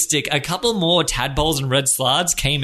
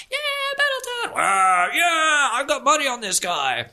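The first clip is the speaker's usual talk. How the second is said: crowd fan; 11 semitones higher than usual. Acted. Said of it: "flags get thrown".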